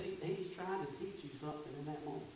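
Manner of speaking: quick, quiet and voiced; soft restatement. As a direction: say it again gently but briskly. Repeated line after the whisper.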